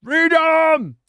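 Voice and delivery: high, loud drawn-out shout; Wallace battle cry. Acted. Streamer hyped for Warhammer Wallace, mock battle cry. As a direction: bellow it like a rallying cry. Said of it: "excited to see Warhammer Wallace".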